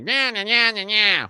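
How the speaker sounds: high nasal eighties movie nerd. Retold or imitated. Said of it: "stereotypical nineteen eighties movie nerd voice".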